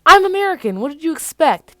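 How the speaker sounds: loud, high indignant shout. Acted. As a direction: protest at full volume. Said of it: "Peter shouts".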